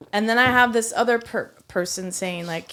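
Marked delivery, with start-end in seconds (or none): none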